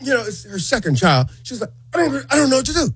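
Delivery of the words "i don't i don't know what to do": high, stumbling, helpless voice; imitated panic. Pregnant manager tells Al she's lost, acted panic. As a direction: mimic someone flustered and helpless.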